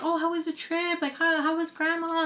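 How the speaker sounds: high, soft sweet voice; sweet mom voice. Retold or imitated. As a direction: coo it sweetly to the kids.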